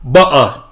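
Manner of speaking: "Butter" with a glottal stop for T, no R; mimicked British glottal T. Viewer jumping in to mimic UK speech, "Butter" with T dropped. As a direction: swallow the T in "Butter", mock British. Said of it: "In the U K".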